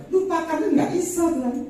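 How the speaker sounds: re-enacted pleading refusal; acted protest. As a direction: protest loudly, pitched right up. Acted protest to God over forgetting.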